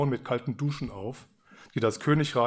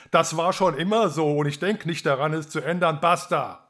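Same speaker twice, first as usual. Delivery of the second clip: loud, higher, a king's final word; angry king; 5 semitones higher, 5 dB louder than usual. A narrator's reading. King refusing to change tradition, loud and angry. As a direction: slam the door on the argument with a loud Basta. King ends daughter's argument, frowning.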